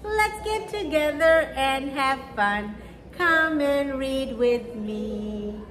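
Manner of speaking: normal talking voice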